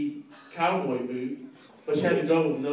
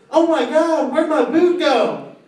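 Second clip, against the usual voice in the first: high, loud, slow mock alarm; shocked shriek; 12 semitones higher, 11 dB louder, slower than usual. Date in the hot tub discovering her boot is gone, loud high shriek. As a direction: shriek it, suddenly missing a boot. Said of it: "next words out of the girl's mouth".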